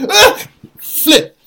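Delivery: sudden high yelp of fright; startled shriek, then a gasp; screaming at a spider. Real, not acted. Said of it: "Are you okay?".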